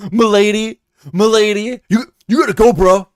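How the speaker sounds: loud mock bro voice; frat bro. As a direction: bark it like a campus bro. Mocking voice continued, laughing.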